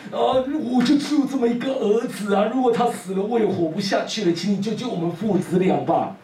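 desperate father. Acted.

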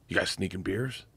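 low, hushed imitation of a sly offer; conspiratorial. Comic sounds out teenagers about beers, imitated low and conspiratorial. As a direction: drop it soft and low, on the sly.

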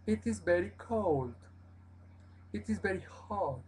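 the tune falls on "cold" and "hot"; entonación que baja al final; falling sentence end. Shown as a demonstration. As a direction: let the voice drop at the end of "It is very cold". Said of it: "Siempre hay que bajar la acentuación al final".

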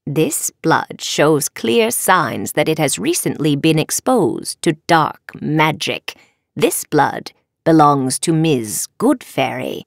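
slow, deliberate professor's accusation; measured and ominous; stern professor. Acted. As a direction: deliver the damning findings word by word.

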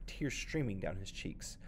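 usual speaking voice

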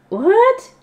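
high, startled yelp of a question; shocked what. Acted. Girl startled by her attendant's walk plan, yelping what. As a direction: jolt upward in disbelief.

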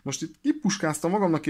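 ordinary talk, nothing marked

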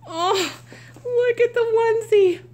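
high, loud, quick gushing; real excited outburst; delighted squeal. Real, not acted. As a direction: squeal it out in a thrilled rush. Delighted at the onesie.

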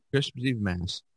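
ordinary talk, nothing marked

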